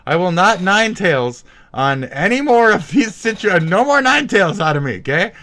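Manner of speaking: loud, high, hammed-up outburst; mock-dramatic vow. Streamer vows to hold back his inner shock beast, mock-dramatic. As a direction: vow it at the top of your voice, hammed up.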